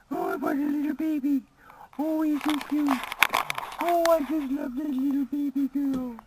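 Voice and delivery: very high, gushing mock dog-owner voice; mock baby talk. Vlogger mocking dog owners' baby talk, very high and gushing. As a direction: send up people cooing at dogs. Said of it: "Why do people talk like that".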